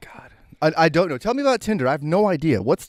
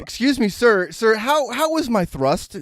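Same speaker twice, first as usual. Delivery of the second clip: high, prissy put-on voice, slowing on the thrust question; mock polite neighbor; 9 semitones higher, slower than usual. Cohost imagines neighbor knocking at adjoining door, mock polite. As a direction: play an awkwardly polite hotel neighbor.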